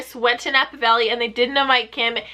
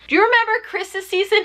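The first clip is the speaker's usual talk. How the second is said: ordinary talk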